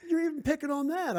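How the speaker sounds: high-pitched mock-indignant comeback; retold protest. Retelling his retort to her.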